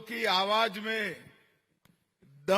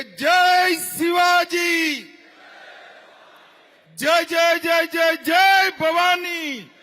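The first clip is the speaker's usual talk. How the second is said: high, slow war-cry shouts; roaring rallying speech; 8 semitones higher, 6 dB louder, much slower than usual. Real, not acted. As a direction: lead a thundering victory cry. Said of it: "Shivaji Maharaj का जयकारा लगाएंगे।".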